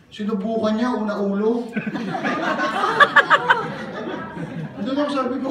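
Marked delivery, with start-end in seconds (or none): 1.7-4.8 high laugh. Real, not acted. Laughing at the story.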